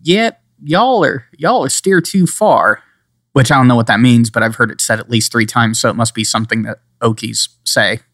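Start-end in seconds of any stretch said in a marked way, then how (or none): 0.0-2.8 slow, high-pitched Oklahoma twang; mock Oklahoma accent, very slow; Okie drawl. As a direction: drag it out like a hardcore Okie. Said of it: "hardcore Okies sitting here going".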